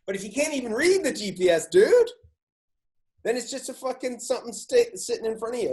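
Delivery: high, loud exasperated rant; rising into an incredulous outburst. Real, not acted. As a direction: go off about the useless screen. Ranting that the GPS is unreadable.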